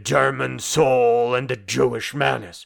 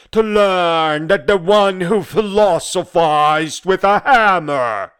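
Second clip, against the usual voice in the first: loud, high, indignant declamation; raised voice, ringing with scorn; scornful outburst; 7 semitones higher, 8 dB louder than usual. Acted. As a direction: throw their gloating back at them, loudly. Bitter mock of his detractors.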